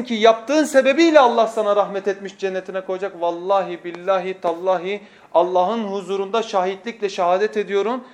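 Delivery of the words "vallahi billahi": normal talking voice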